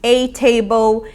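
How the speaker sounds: "A" said as "e": e table, slow; "A" pronounced like E; a with E sound. Shown as a demonstration. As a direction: say "A table" with the article as an E, slowly. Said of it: "pode ser pronunciado com som de E".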